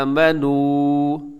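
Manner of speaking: slow आमनू, long first आ and final ऊ; lengthened vowels. Shown as a demonstration. Teacher showing students the madd, आमनू with lengthened vowels. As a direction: stretch the opening and closing vowels of "आमनू". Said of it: "लंबा कीजिएगा".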